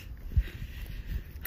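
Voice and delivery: long breathy sigh; deep sigh. Real, not acted. Sick man sighing while describing the pain. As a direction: sigh out the weariness. Sighs about his illness.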